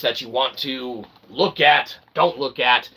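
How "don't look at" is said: ordinary talk, nothing marked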